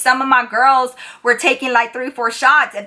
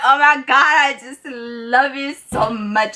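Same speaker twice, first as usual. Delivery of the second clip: put-on drunk gushing; slower than usual. Drunk friend thanking the bartender.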